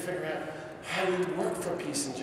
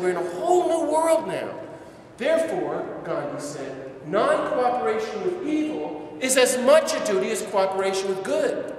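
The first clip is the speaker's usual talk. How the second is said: loud, high proclaiming of Gandhi's words; preaching Gandhi with fire; fervent quotation; 7 semitones higher, 5 dB louder than usual. Retold or imitated. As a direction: lift the quote up like a banner. Preacher quotes Gandhi with fervour.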